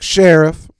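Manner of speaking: loud, high, flat one-word answer; curt phone clerk. Retold or imitated. Imitating the sheriff's clerk answering the call, loud and curt. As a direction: bark the greeting like a bored clerk.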